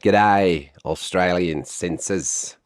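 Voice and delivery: broad, hammed-up Australian impression; bad Australian accent. Vlogger mocking the Australian censors, bad Australian accent. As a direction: put on a shaky-at-best Aussie accent. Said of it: "I'm terrible at Australian accents".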